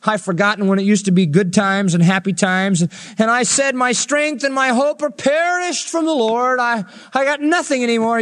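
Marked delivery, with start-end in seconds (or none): 3.8-8.2 despairing prophet. Acted. Voicing despairing Jeremiah.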